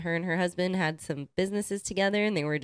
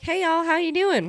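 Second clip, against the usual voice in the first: high, loud Southern greeting; Southern accent; 11 semitones higher, 6 dB louder than usual. Retold or imitated. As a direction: imitate a Southern woman hailing newcomers. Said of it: "nice Southern accent".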